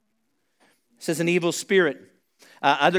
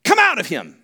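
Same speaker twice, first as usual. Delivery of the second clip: forceful stern order to leave; firm, raised rebuke; stern command; 6 semitones higher, 6 dB louder than usual. Acted. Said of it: "It says he said it sternly".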